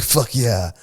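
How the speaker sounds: eager fight taunt. Retold or imitated. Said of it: "I stood up and I was like".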